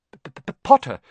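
nervous stuttered P; stammering. Acted. Quirrell greeting Harry, nervous stammer. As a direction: trip over the P. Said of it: "stammered Professor Quirrell".

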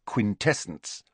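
ordinary delivery

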